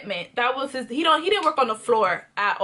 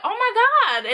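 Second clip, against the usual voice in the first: excited, laughing oh my God; thrilled at the gift, higher and laughing; delighted gasp; 9 semitones higher than usual. Retold or imitated. Opening the Secret Santa gift.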